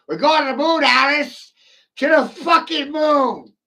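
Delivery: high, loud cheer to Alice; excited shout. Real, not acted. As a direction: yell it out in triumph. Celebrating rocket to moon.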